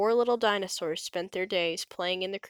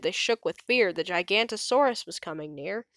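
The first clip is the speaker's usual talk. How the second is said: usual speaking voice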